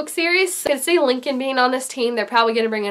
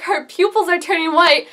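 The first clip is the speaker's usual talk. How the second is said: normal talking voice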